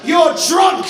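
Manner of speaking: hyped presenter. Acted. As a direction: announce answers with showmanship. Presenter quiz reveal.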